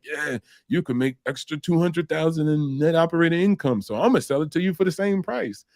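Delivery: high-pitched mock seller; pitching seller.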